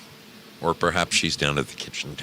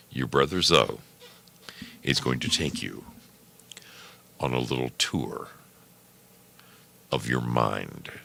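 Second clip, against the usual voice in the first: guided meditation voice; 5 semitones lower, 6 dB quieter than usual. Acted. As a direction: ease them into calm. Lowers into calm guided voice.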